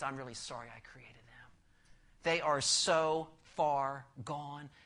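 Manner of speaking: whispered, grieved regret; sorrowful whisper. Acted. God looking down at a corrupt world, whispered sorrow. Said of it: "He goes".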